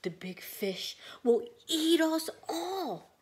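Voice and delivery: slow, loud, whispered fear; scared fish warning, whispered; frightened. Acted. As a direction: hiss it in terror. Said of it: "said the little red fish".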